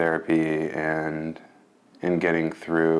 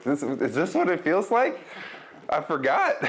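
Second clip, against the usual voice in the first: high-pitched, slow wonder breaking into a laugh; incredulous, joyful disbelief; amazed realisation; 11 semitones higher than usual. Retold or imitated. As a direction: relive the astonishment, voice lifted high. Recalls rediscovering happiness, moved.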